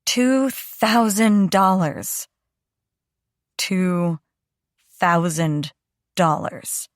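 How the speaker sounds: slow, halting echo of "Two thousand dollars"; drawn-out, dazed repeat of the amount; stunned echo. Acted. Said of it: "Jude echoes".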